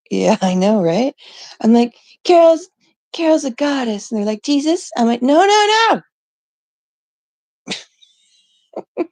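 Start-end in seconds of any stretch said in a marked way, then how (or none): 2.2-4.1 high, loud start, mock insistence; exasperated insistence. Insisting to the AI in retelling. 4.4-4.9 high, rising mock question; clueless query. Voices AI asking same thing back. 5.2-9.0 very high, loud mock wail; hammed-up string of desperate refusals; frantic protest. Retold protest at repeated wrong answer.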